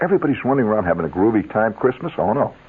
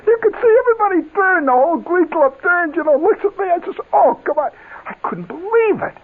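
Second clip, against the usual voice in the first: excited disbelief. Real, not acted. Stunned at being picked.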